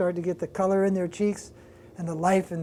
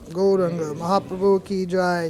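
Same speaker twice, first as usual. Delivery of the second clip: devotional call; 4 dB louder than usual. Real, not acted. Closing call of devotional praise.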